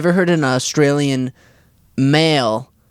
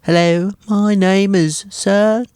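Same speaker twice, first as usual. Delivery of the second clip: high English voice; 4 semitones higher than usual. Shown as a demonstration. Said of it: "maybe a little child".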